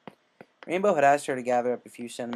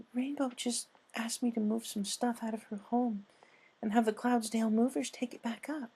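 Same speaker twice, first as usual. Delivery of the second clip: high timid pony asking kindly; gentle Fluttershy; 10 semitones higher than usual. Acted. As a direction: voice a timid caring pony, much higher. Narrator voices Fluttershy.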